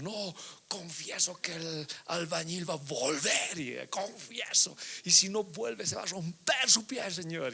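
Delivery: lento y teatral, como quien exagera una oración; confesión burlona. Pretend. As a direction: parodia una confesión presuntuosa ante Dios. (confesión fingida en tono de burla)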